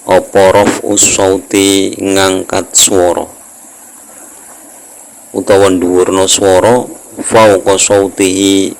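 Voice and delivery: slow, drawn-out reading of Arabic with Javanese gloss; kitab recitation. Acted. Teacher reading a religious text to students, slow measured recitation. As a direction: stretch the vowels in a measured recitation.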